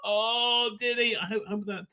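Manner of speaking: normal talking voice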